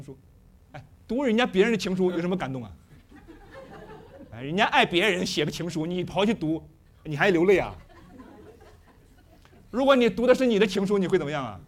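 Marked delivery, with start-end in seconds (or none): none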